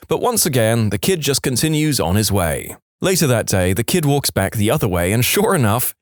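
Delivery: ordinary talk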